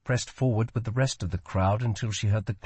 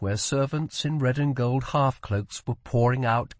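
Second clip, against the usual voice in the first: plain reading voice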